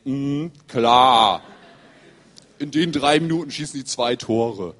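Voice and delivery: loud, drawn-out sceptical "klar"; mock disbelief. Friend doubting the two late goals, mock disbelief. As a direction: scoff as if you don't buy it.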